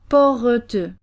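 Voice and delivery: "porteux" slowly, with an extra E between R and T; added E sound. Shown as a demonstration. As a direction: stretch "porteux" out, a French E after the consonants. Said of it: "add a E sound between the two consonants".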